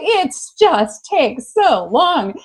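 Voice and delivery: slow, high-pitched venting; exasperated. Real, not acted. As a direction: stretch each word out in frustration. Venting about slow friendship-making.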